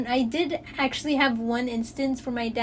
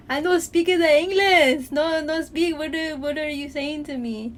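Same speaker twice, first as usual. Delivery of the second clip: put-on thick Mexican accent, higher and louder; exaggerated Mexican accent, protesting loudly; heavy Mexican accent; 4 semitones higher, 5 dB louder than usual. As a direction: mimic a dad faking heavy Mexican English. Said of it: "with the heaviest Mexican accent".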